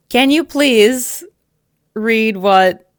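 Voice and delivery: high, slow, loud put-on Invercargill accent; mock Invercargill accent. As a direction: ham up a southern Kiwi accent. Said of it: "Like you're reading it with an Invercargill accent".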